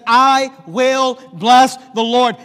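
proclaimed vow. Acted. Preacher quoting David's vow.

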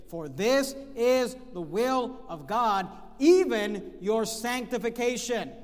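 slow and loud, each word weighted; preached scripture. Acted. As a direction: proclaim it to the room. Reading scripture with force.

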